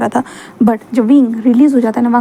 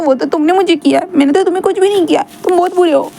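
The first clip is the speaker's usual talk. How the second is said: higher whiny voice, hurt, building to anger; sulky young man; 6 semitones higher than usual. Acted. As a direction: pout and complain like a wounded boy. Wing voiced.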